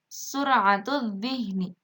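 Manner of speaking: slow "sur'atul dhihni", L merged into held DH; silent lam. Shown as a demonstration. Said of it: "Itu juga sama".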